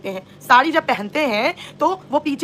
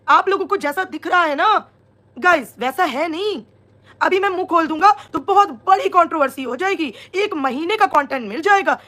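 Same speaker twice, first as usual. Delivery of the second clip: higher-pitched mocking imitation of his live; mock quoting a man; 4 semitones higher than usual. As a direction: mimic his hinting announcement in a raised pitch. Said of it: "live में indirect वे में सबको बोल रहा था". (नकल उतारते हुए ऊँचे सुर में)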